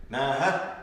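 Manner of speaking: NAHA, rising into a strong last syllable. Shown as a demonstration. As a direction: hit the end of Naha harder. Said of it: "Same thing with a woman".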